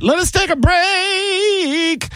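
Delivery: high, drawn-out sing-song call; playful high announcing. Pretend. Host calling a commercial break, playful high announcing. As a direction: stretch the last word way up high.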